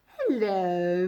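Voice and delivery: low, purring cat greeting; meowing cat. A narrator's reading. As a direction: meow the hello like a lazy cat. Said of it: "meowed the cat".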